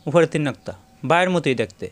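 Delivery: ordinary talk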